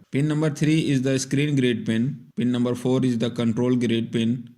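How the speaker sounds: ordinary talk, nothing marked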